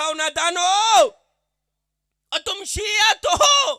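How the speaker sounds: loud, high, slow scolding cry; fervent rebuke. Acted. Preacher rebuking sectarian listeners, loud and impassioned. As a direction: shake the foolish crowd awake. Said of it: "میں نے کہا".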